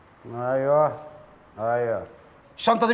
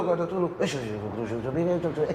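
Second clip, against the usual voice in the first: soft mock shushing hiss, fading lower; imitating courtroom whispering; 4 semitones lower, 9 dB quieter, faster than usual. [name] mimicking courtroom whispers he saw in films, mock hiss. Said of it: "بشوفهم في السينما بيعملوا كده".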